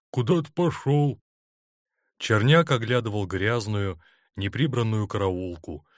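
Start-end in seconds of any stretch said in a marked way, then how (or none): 0.1-1.2 vague, higher-voiced dopey answer; mumbled. A narrator's reading. Orderly explaining the commandant's absence, mumbling. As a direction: mumble it as a clueless subordinate. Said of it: "промямлил тот".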